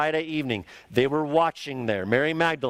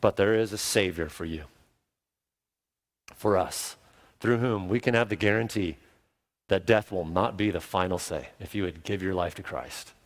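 tender appeal; 7 semitones lower than usual. Real, not acted.

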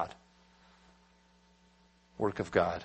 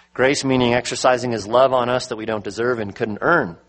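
normal talking voice

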